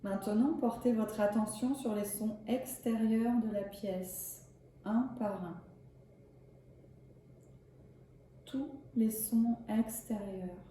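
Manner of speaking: calm, measured yoga nidra guidance; unhurried relaxation voice with spacious pauses; guided meditation. Acted. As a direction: guide them gently into rest, leaving long pauses. Leads a yoga nidra session.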